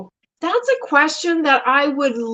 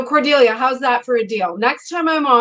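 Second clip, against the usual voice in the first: normal talking voice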